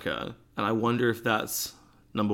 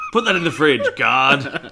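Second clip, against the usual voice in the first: loud, higher mock command; mock order; 4 semitones higher, 9 dB louder than usual. Ordering an imagined security guard about the milk, loud mock command. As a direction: bark it at the guard in jest.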